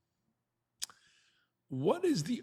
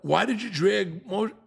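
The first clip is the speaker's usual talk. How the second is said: stammer, then higher-pitched mock protest; Korach's indignant reply; 6 semitones higher than usual. Korach answering Moshe, a hammed-up indignant protest. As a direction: play Korach as offended and scoffing. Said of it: "What's his answer to Moshe saying".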